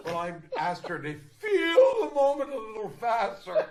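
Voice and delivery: high laughing delivery; giggling loudly. Real, not acted. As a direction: laugh through the punchline. Laughing at own quip.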